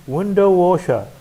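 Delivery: window washer with WIN high and loud; stress on WIN. Shown as a demonstration. Teacher reading compound word aloud, window washer stressed on WIN. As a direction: punch the first syllable of window, let washer fall. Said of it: "stress on win".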